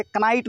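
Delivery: "कनाइट" with the K voiced before N; K sounded. Shown as a demonstration. Teacher warns class off this reading, K sounded in "कनाइट". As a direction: say "कनाइट", wrongly sounding the silent K. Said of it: "नहीं पढ़ सकते".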